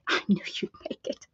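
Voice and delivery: fast breathy whisper. Real, not acted. Whispering her welcome to a chat viewer. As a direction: repeat it under her breath.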